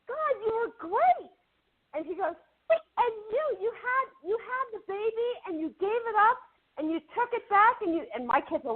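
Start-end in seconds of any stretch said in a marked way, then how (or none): 0.0-1.3 very high-pitched, excited praise; retold gushing. Co-star praising the actor she just recognized, gushing. 2.7-7.8 high, eager imitation, pitch held up throughout; retold excited recall. Actor recalling her soap storyline in public, excited.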